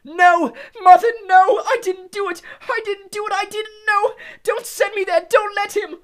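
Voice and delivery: very high, very loud screaming. Acted. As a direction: scream in terror as he's sentenced. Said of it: "The boy began to scream".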